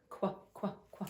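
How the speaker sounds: qu, qu breathed short and soft; isolated qu sound. Shown as a demonstration. Teacher modeling the qu sound for the class. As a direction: repeat the qu sound softly, crisp. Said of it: "makes the qu sound".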